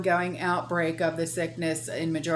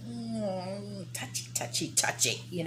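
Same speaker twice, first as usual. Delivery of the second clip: hushed unvoiced whisper; 11 dB quieter than usual. Real, not acted. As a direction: breathe it out conspiratorially. Confiding sensitive topic.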